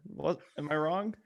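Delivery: ordinary delivery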